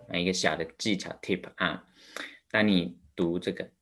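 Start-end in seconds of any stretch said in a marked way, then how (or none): none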